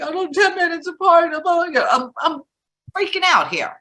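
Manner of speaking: high, loud, frantic shout; freaking out. Retold or imitated. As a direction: yell like the contractions just hit. Retold panic on phone.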